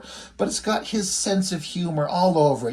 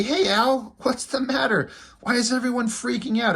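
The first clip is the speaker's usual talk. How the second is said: higher, lightly voiced confused zombie; bewildered zombie hailing his pal; zombie calling to buddy; 8 semitones higher than usual. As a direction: call out puzzled to a friend.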